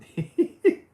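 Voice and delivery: high loud cartoon hyuck laugh; Goofy laugh. Streamer does the laugh chat enjoys, high and loud. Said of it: "I'm glad you like my laugh".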